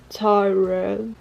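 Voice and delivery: tired repeated, each ending on a rise; practicing tired. Shown as a demonstration. French speaker drills tired for American friends, careful pronunciation. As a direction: try the English word tired, stretched and rising. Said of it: "Sorry, I'm tired".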